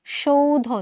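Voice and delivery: সৌধ drawn out slowly, "shou-dho"; careful "sh" sound. Shown as a demonstration. Teacher asking students to listen, সৌধ slow with initial "sh". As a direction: start সৌধ on "sh", stretching the vowel. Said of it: "উচ্চারণটা ভালো করে খেয়াল করো".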